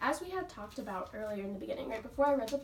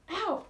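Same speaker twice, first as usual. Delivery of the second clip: high yelp of pain; sharp ow of pain; 9 semitones higher, 4 dB louder than usual. A narrator's reading. Jeremy tugs Ramona out, pained yelp. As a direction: yelp as you're yanked. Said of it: "said Ramona".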